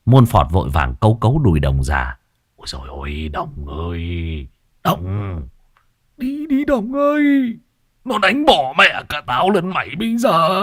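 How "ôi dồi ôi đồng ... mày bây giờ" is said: put-on high, pleading old man voice; a frightened old codger begging his friend to flee; panicked old man. A narrator's reading. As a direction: squeal the warning at your friend in panic.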